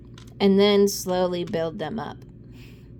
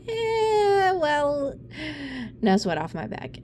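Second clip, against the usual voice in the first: mock nonchalance; 5 semitones higher, slower than usual. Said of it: "And I'd be like".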